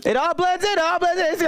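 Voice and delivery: usual speaking voice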